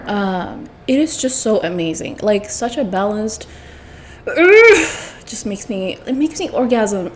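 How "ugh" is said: loud, high groan over delicious food; groan, overcome by the taste; groan of pleasure. Real, not acted. Overcome by how good food is.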